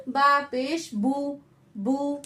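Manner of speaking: slow spelled बा पेश बू, loud clean ऊ; बू with a pure long oo, not o; Arabic oo sound. Shown as a demonstration. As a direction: drill it slowly the Arabic way. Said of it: "ऊ sound आएगा".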